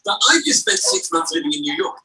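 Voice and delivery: ordinary delivery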